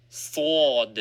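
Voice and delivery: "Thawd" with a heavy emphatic Arabic d. Shown as a demonstration. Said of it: "Thawd has an emphatic pronunciation".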